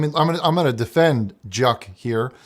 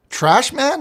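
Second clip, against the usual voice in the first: put-on echoing the caller's Scottish accent, higher; Scottish accent, pitched up as a question; mock Scottish; 12 semitones higher than usual. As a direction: parrot the Scottish trash man. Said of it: "I can't tell with your accent".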